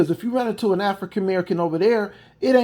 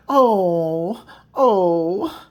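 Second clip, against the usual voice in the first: mock whimper. Mock sobbing parody.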